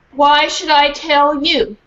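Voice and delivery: grumpy snob dog. Acted. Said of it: "she said".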